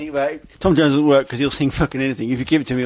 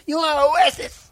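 mock accusing; 12 semitones higher than usual.

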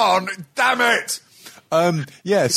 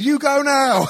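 put-on curt Chinese accent; 3 dB louder, slower than usual. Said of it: "imagine I'm saying this in a Chinese".

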